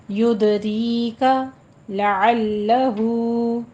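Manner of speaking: लअल्लहु with a hard doubled लाम; tajweed demo. Shown as a demonstration. Teacher shows the shadda on lam, slow. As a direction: press the लाम in लअल्लहु firmly. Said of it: "यहाँ पे लाम के ऊपर तशदीद अदा करनी है".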